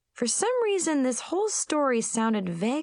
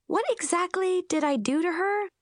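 nervous; 11 semitones higher than usual. Acted. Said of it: "I asked nervously".

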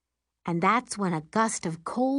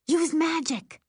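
crying out; 9 semitones higher, slower than usual. Acted. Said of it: "I cry".

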